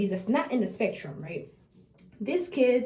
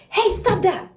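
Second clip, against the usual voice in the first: put-on higher, louder warning to a toddler; sharp hey; 11 semitones higher, 6 dB louder than usual. Parent stopping baby at the gate, sharp warning. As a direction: snap a quick warning at the baby. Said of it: "you're like".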